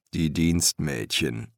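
low, slow grumble; grumbling master embalmer. A narrator's reading. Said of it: "brummte Dill".

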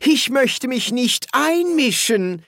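a prim housekeeper excusing herself; housekeeper's voice. Acted. Housekeeper interrupting the quarrelling wizards, high and polite.

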